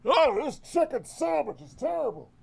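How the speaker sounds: mock the guy wandering off set; a squeaky, high complaint from offstage; imitating DSP. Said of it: "And then you just hear".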